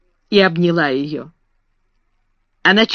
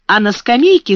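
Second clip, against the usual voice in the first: plain reading voice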